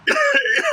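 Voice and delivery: high, loud burst of laughter. Real, not acted. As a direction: let out a squealing laugh. Laughing after the coughing fit.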